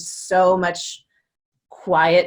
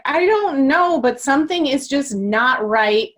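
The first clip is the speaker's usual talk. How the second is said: distressed self; 6 semitones higher than usual. Retold or imitated. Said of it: "I'm like".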